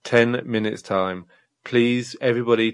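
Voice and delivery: ordinary talk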